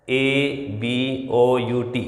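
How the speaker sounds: letters spelled out slowly one by one; slow spelling. Shown as a demonstration. Teacher spelling a dictionary word for students, slowly.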